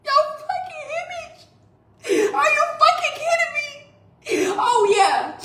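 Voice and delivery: high, tearful, disbelieving shout; yelling at him through sobs; crying shout. Real, not acted. Crying outburst at husband.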